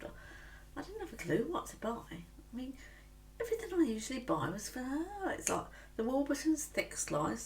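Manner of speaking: normal talking voice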